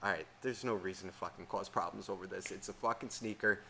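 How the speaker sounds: low, hushed self-soothing; forced calm. Retold or imitated. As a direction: talk yourself down softly, eyes closed. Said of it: "And I'm calm".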